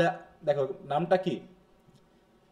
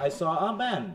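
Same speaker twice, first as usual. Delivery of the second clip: "man" stressed and falling at the end; focus on MAN; much slower than usual. Shown as a demonstration. Teacher answering "whom did you see", stress on "man". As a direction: answer with the weight on "man", letting it fall. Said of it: "অতএব তোমার উত্তর হবে কি?".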